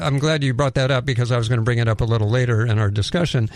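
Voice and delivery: ordinary delivery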